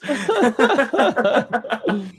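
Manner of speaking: bursting out in a big laugh; a real high laugh that breaks loose; loud laugh. Real, not acted. Laughs at own beast mode story.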